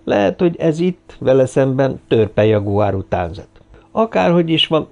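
ordinary delivery

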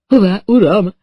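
startled high question; a frightened butler's question; alarmed. A narrator's reading. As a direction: ask it wide-eyed and frightened. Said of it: "kérdezte riadt szemekkel".

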